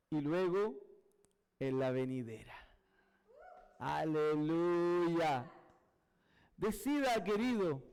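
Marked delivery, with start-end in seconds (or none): 3.8-5.5 long, sustained low hallelujah; slow preacher's exclamation; drawn-out praise. Real, not acted. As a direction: stretch every vowel out slowly and firmly.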